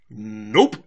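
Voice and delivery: low, loud one-word refusal; Big Mac. Retold or imitated. Pony asked to give the doll back, low flat refusal.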